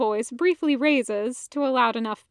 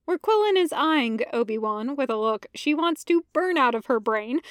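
ordinary delivery, nothing marked

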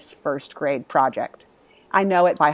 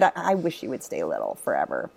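tender; 13 semitones lower, 9 dB quieter than usual. Retold or imitated. Tucking son in at night.

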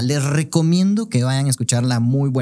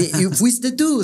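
mock surprised girl; 8 semitones higher, slower than usual. Girl asking in retold story.

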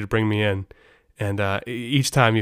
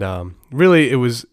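ordinary talk, nothing marked

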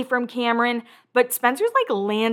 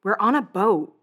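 deadpan quote. Retold or imitated. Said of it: "is all that Lauren says".

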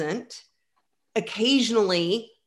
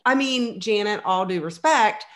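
usual speaking voice

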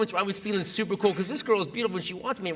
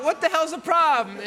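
indignant; 9 semitones higher, 4 dB louder than usual. Retold or imitated. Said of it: "And I go".

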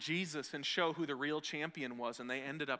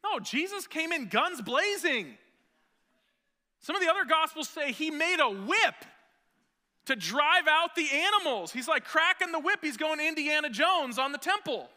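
much higher and louder, slowed, fiery sermon; full-force preaching; 12 semitones higher, 11 dB louder than usual. Acted. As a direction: preach it at the top of your lungs. Preaching burst.

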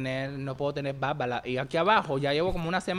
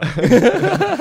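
high, loud burst of laughter; helpless laughing fit; 13 semitones higher, 9 dB louder than usual. Real, not acted. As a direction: laugh hard at the joke. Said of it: "Ay, me meo".

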